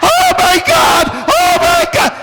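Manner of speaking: high-pitched comic screaming; imitated screaming. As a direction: shriek it like a horrified man fleeing a stench. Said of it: "is screaming".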